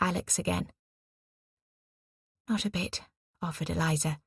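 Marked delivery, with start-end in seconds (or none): 2.5-3.0 softly wistful, a little raised in pitch; soft melancholy. A narrator's reading. Eliza reassures her friend about her roots, softly melancholy. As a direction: reassure gently with a touch of sadness. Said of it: "a soft melancholy reflecting in her voice".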